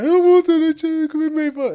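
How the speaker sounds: put-on high, loud crying-boy protest; upset kid whine. Boy telling dad he won't wear sweatshirt, high crying whine. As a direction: wail it like a hurt little kid. Upset child voiced in story.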